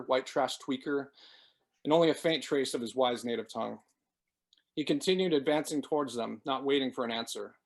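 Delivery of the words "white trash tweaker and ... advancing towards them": usual speaking voice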